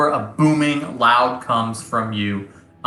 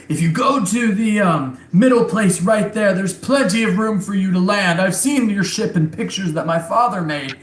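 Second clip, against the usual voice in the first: a slightly higher chieftain voice; lightly voiced chieftain; 6 semitones higher than usual. Chieftain directing the pilot where to land, lightly higher. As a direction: guide them in as a helpful chief. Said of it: "he's like".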